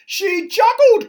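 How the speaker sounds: high, loud, drawn-out shocked cry. A narrator's reading. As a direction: shriek it as a scandalised king. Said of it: "cried the king".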